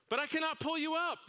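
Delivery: high, pleading outcry; the man cries out to the stranger below; cried out. Acted. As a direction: cry out in helpless strain. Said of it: "the man cried".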